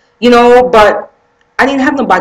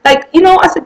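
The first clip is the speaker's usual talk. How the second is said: normal talking voice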